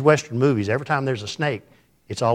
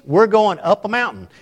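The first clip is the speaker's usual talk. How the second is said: retold scream; 6 semitones higher, 3 dB louder than usual. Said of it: "Mother screams".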